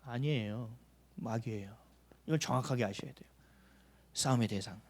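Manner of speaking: soft, grave emphasis; quiet and low. Real, not acted. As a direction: bring it down low and firm. Preacher answers his own question.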